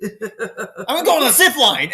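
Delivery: high, loud, drawn-out announcement; hammed-up huffy shout; mock outburst. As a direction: declare it like a sulky exit, half laughing. Acting out the complainer's exit.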